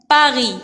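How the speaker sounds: "Paris" ending on the i, s silent; silent final s. Shown as a demonstration. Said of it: "S à la fin ne se prononce pas".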